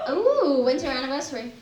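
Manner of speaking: ordinary delivery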